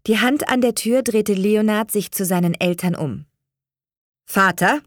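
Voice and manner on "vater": louder, firm address. A narrator's reading. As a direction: claim the father's attention. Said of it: "sagte er mit fester Stimme". (mit fester Stimme)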